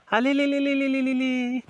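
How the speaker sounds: high, drawn-out "li-li-li" trill; tongue-wagging coo to amuse a baby; playful baby trill. Acted. Visitor amusing a neighbour's grandchild, high lilting trill.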